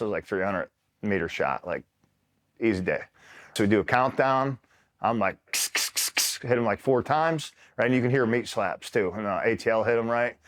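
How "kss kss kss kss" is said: whispered kss kss mimicking suppressed shots; softer hissed gunfire imitation; suppressed gunshot sounds. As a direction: breathe out sharp unvoiced shot noises.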